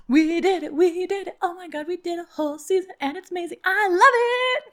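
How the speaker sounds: celebrating, can't contain it; giddy relief. Real, not acted. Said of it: "I'm celebrating".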